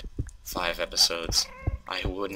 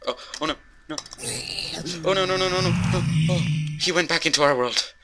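panicked; 8 semitones higher, faster than usual. Real, not acted. Sees foe escape into world.

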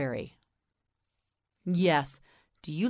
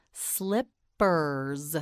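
"Slippers" slowly, gap between slip and pers; syllable by syllable. Shown as a demonstration. Teacher models for learner, "Slippers" in slow separate syllables. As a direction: break "Slippers" into two drawn-out syllables. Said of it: "You say it".

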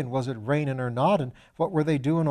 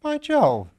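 high, loud burst of surprise; astonished exclamation; 8 dB louder than usual. Acted. Piggott realizing Lord Peter was right, loud astonished cry. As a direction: shout it out, caught completely off guard. Piggott startled at losing the bet.

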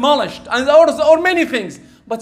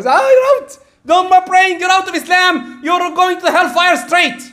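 mock harsh condemnation; 8 semitones higher than usual. Preacher imitating a judgmental man condemning his brother, mock harsh. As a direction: throw him out of the faith like a zealot. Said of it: "Don't tell him you're a kafir or".

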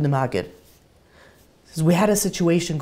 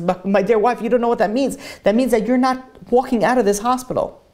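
mildly distraught, higher-pitched plea; retold crying protest; 9 semitones higher, 5 dB louder than usual. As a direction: protest to your wife as if upset. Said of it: "the husband starts crying".

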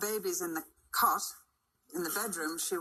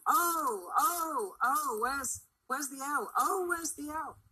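anxious cry; 8 semitones higher, slower than usual. Acted. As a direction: cry out like a fretful mother. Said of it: "emphatic expression of anxious love".

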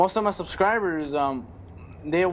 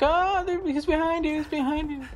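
much higher mock panicked warning; imagined viewers screaming; 11 semitones higher than usual. Viewers yelling at the gamer, high mock panic. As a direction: squeal it like frantic viewers warning him. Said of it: "They're like".

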